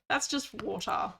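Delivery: usual speaking voice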